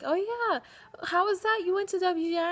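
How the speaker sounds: mock impressed. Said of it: "and they're like".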